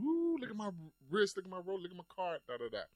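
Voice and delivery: high, showy brag about the wrist and car; mock bragging. Boasting to everyone in a mock show-off voice. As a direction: show off like a flashy rapper. Mocking flashy rapper bragging.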